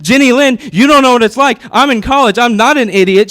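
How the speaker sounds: hammed-up indignant big brother; mock indignant. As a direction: argue back like a wounded grown-up. Heated argument with sister retold.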